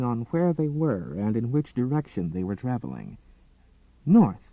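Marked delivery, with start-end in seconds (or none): 4.1-4.5 alarmed realization. A narrator's reading.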